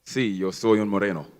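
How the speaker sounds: Spanish with an American accent; halting Spanish, American accent. Real, not acted. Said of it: "mi español es muy pobre". (español con acento americano)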